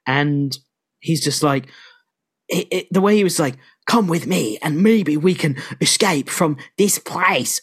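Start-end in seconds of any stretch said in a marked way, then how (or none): 3.9-7.6 slow, wandering attempt at an English accent; Kevin Costner. Prisoner urging escape from a Jerusalem prison, bad English accent. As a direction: stretch it out in a muddled English accent. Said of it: "he's trying to do an English accent".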